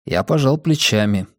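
ordinary delivery

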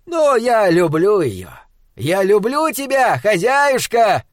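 high, loud mock-tender call; husband calls his love to his wife; shouting fondly. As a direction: shout love to her playfully. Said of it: "крикнул он ей".

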